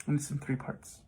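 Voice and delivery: ordinary talk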